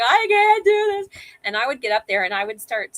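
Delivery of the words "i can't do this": high, tearful wail, slowed; retold crying plea, pitched high; imitating herself in tears. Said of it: "crying, going".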